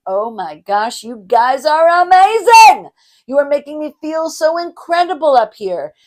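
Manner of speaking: loud, high, gushing compliment; excited gushing. Shown as a demonstration. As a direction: gush over the crowd. Example gushing compliment to audience.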